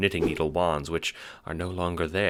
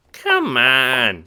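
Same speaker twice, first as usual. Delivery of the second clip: squeaky chipmunk wiseguy, impatient; 7 semitones higher, 7 dB louder than usual. Acted. Little guard urges the Seer down the stairs, impatient. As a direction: hurry her along, squeaky. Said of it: "he says impatiently".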